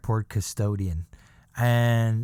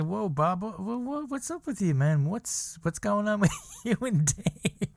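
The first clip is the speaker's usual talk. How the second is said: surprised; 8 semitones higher than usual. Retold or imitated. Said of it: "I said".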